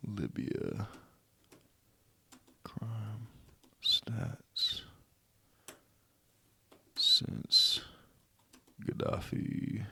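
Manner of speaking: barely voiced whisper while searching; whispered to himself. Real, not acted. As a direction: whisper the search terms to yourself, half absent.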